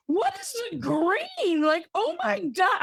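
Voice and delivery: hammed-up gasp of horror at a photo; mock horror. Friend seeing the green photo, hammed-up horror.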